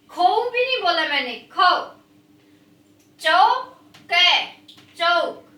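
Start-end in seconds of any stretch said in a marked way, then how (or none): 3.2-4.4 चौके drawn out very slowly and a bit louder; slow sounding out. Shown as a demonstration. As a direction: stretch चौके syllable by syllable for kids. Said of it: "आप भी बेटा साथ साथ बोलेंगे".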